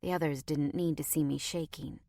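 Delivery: ordinary delivery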